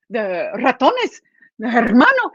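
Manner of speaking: ordinary delivery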